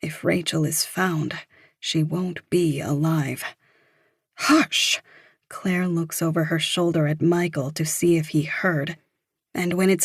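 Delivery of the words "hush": a sharp, high whispered hush. A narrator's reading. Claire silencing Evie near Michael, sharp whispered hush. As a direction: shush her urgently without voice. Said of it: "Claire is hush-yelling into her ear".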